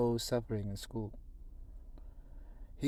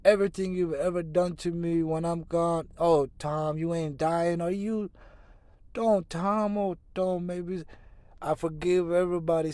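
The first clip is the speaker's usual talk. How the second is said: panicked; 6 semitones higher, 6 dB louder than usual. Acted. Dying boy, brother panics.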